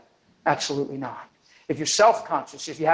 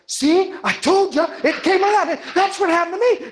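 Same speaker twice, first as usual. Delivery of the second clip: excited vouching; 11 semitones higher than usual. Retold or imitated. Healed man tells the woman it worked, excited shout. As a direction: shout it out like proof.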